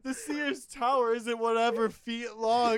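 laughing mock quote. Acting out people measuring in dicks.

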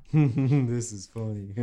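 slow, goofy self-amused chuckle; drawn-out amused mumble with chuckles; chuckling to himself. Retold or imitated. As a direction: act out giggling slowly at a screen. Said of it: "I'm like".

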